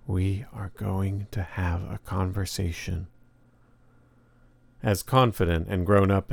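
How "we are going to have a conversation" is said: soft, slow, deliberate near-whisper; softly confiding into a tape recorder, slowly; hushed whisper. A narrator's reading. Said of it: "and then whispers".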